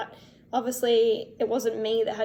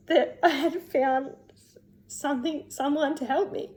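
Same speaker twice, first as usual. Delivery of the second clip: real emotion welling up; 3 semitones higher than usual. Real, not acted. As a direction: overcome as the relief sinks in. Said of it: "I don't know why I'm so emotional".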